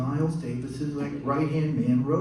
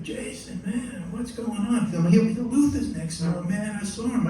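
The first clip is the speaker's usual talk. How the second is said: lightly imitated bandleader, pitched up; higher-voiced friendly greeting, a hint of impression; 8 semitones higher than usual. Bandleader greets keyboardist with a hug, lightly imitated. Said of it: "So [name] sees me".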